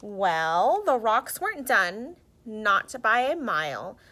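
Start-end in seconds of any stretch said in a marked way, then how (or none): none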